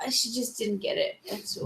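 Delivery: breathy whisper, almost no voice; whispered aside. Real, not acted. Cohost whispering a catty aside about the contestant, hushed. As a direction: lean in and whisper the remark.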